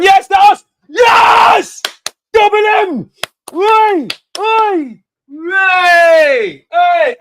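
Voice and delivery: screaming high with joy; goal celebration. Real, not acted. Fan yelling as his team scores, ecstatic shout. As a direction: erupt in a wild goal cheer.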